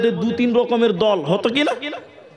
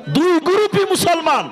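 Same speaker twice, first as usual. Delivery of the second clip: higher, punched point; emphatic answer; 8 semitones higher than usual. Real, not acted. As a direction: drive the answer home. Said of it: "এরা কি অমুসলিম না দুই গ্রুপই মুসলমান?".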